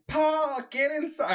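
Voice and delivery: high, bossy mom call; a high hammed-up mom calling him in. Mom in the helicopter calling her son, high bossy.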